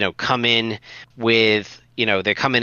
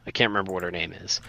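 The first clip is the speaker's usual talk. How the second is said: ordinary talk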